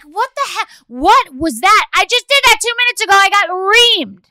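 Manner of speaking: loud, high indignant outburst; outraged disbelief. Retold or imitated. Mom outraged daughter thanked others, loud and high. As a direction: blow up in disbelief. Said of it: "I'm like".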